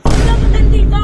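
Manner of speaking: loud angry yell; angry shout. Acted. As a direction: shout it in protest. Drunk friends bickering.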